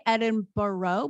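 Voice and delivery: slow "Edinburgh" as ED-in-BURR-oh; drawn-out American "Edinburgh" with -burrow ending; American pronunciation. Retold or imitated. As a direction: end "Edinburgh" like the word borough. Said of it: "still say".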